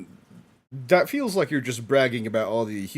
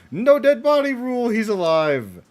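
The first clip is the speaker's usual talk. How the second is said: "No dead body rule" shouted higher and louder; excited repeat; 9 semitones higher, 6 dB louder than usual. Real, not acted. Viewer proven right about Speedwagon, shouting the rule again. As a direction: crow the rule in triumph.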